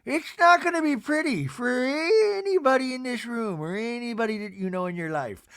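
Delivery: imitated veiled threat, settling at the end; weird threat. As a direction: threaten them in an affected singing swoop. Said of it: "she'll, like, weirdly threaten".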